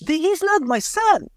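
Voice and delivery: retold protest, high and stretched; insistent denial. Father denies the body is his.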